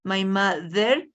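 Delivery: My mother slowly, voiced th in mother with tongue between teeth. Shown as a demonstration. Teacher slowly modelling mother for the class. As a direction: stretch out mother, tongue showing on the th. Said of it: "Mostramos también la lengua".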